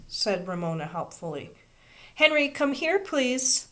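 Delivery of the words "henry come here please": higher, louder call. A narrator's reading. Teacher calling Henry to help, raised voice. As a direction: call the boy over kindly. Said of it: "called Miss Binney".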